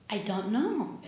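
soft, small sheepish answer; sheepish mumble. Retold or imitated. Daughter caught out by her mother, soft and sheepish. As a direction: shrink away from the question.

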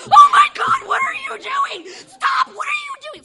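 very high, very loud shriek; panicked scream. Retold or imitated. Shriek at Steven caught in the biscuits, panicked scream. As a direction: scream at him in horror. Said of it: "I freaked out".

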